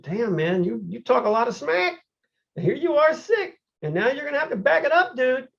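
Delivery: high, mock-taunting voice at himself; scolding himself. Sick athlete on the sofa mocking himself in a high voice. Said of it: "I was talking like that".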